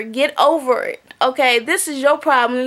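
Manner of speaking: ordinary delivery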